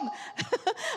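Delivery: laugh. Real, not acted. Pastor laughs at own story.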